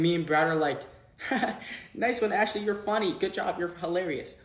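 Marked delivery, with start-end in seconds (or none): 1.2-1.9 mocking laugh. Mocking Ashley in the elevator. 1.9-4.4 higher, sarcastic congratulation; teasing sing-song mockery of a friend; sarcastic mock praise. Mocking Ashley in the elevator.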